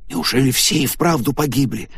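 put-on shocked young man. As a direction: ask it in disbelief. Stunned friend asks about deaths.

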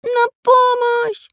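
high, long-drawn squeaky call; faint cry for help. A narrator's reading. Lost pencil calling from the thicket, crying for help. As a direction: stretch out a high cartoon cry. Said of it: "Снова раздался слабый голос".

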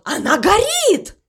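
high-pitched alarm; alarmed cry. A narrator's reading. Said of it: "кричал он". (тревожный крик высоким голосом)

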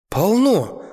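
high, bright burst of joy; delighted. A narrator's reading. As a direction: blurt it out thrilled with the find. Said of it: "восторженно ответил Герман".

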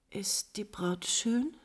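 slow, pointed whisper; jealous whisper. Acted. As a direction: lean in and whisper it. Countess's jealous hushed question.

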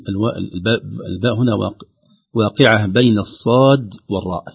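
ordinary talk

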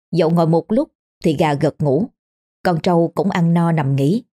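ordinary delivery, nothing marked

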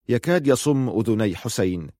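ordinary delivery

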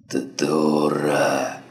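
slow, stammered, exhaled insult; sharp breathed-out exclamation. Acted. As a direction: gasp it out sharply. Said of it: "резко выдохнул Бидон".

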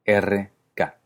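normal talking voice

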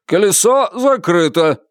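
high, deliberate proprietor's call; repeated announcement. A narrator's reading. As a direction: announce it firmly so nobody misses it. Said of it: "и для верности повторил".

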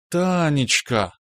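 slow, affectionate drawn-out name; tender drawl. Acted. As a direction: stretch the name out sweetly. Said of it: "ласково протянула Анечка". (ласково протянуто)

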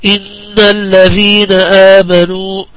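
slow chant, humming long on the doubled ن of إن; tajweed ghunnah recitation. Shown as a demonstration. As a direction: hold the نّ of إن nasally, then recite الذين آمنوا with long vowels. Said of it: "وجوب الغنة فيهما".

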